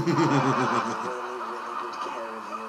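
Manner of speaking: deep reassuring corporate charmer; smooth Disney suitor. Retold or imitated. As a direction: croon it like a smooth-talking lover.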